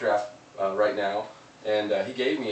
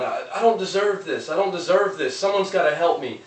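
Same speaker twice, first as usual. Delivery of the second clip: higher, pleading mock yell from the hole; retold yelling; 7 semitones higher, 5 dB louder than usual. Man fallen in a hole begs for help, higher mock yell. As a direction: play the man in the hole begging. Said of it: "he starts yelling".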